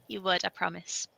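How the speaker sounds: usual speaking voice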